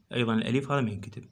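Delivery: ordinary talk, nothing marked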